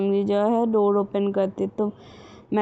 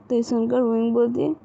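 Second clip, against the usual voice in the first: ordinary delivery, nothing marked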